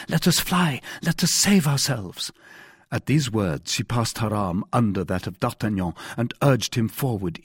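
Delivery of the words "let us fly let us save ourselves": mildly frightened, high-pitched woman; affrighted. A narrator's reading. Frightened wife urges her rescuer to flee, mildly alarmed. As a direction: urge escape with a touch of fright. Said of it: "cried the affrighted Madame Bonacieux".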